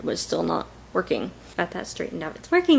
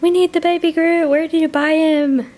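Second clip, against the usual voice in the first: much higher, loud excited gushing; excited strangers; 10 semitones higher, 6 dB louder than usual. Retold or imitated. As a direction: squeal it like delighted fans. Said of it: "people are like".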